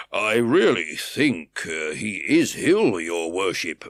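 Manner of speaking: slow, loud, pleading officer voice; remonstrating officer. A narrator's reading. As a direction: plead with the judge, slowly. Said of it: "remonstrated the officer".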